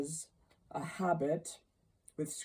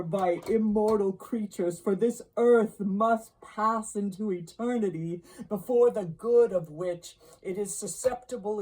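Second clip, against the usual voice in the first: higher-pitched, impassioned ghostly oration; lamenting phantom; 6 semitones higher, 4 dB louder than usual. Acted. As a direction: rise steadily higher into anguished regret.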